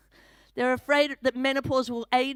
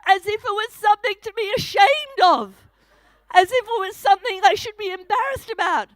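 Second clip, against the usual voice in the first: indignant; 7 semitones higher, 7 dB louder than usual. Real, not acted. Indignant comic rant.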